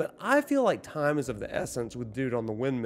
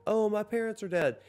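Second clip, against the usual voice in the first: lightly voiced little girl, higher pitch; higher-pitched retelling of a child's words; 6 semitones higher than usual. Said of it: "the little girl is like".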